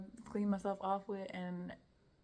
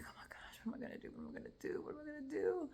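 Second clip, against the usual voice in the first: frantic whisper climbing into a high squeak; whispered panic; 9 semitones higher, 5 dB quieter, faster than usual. Retold or imitated. Woman retelling her panic as she feels weaker, panicked whisper. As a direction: whisper it in rising desperation. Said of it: "I panic".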